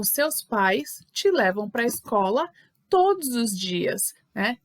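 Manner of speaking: normal talking voice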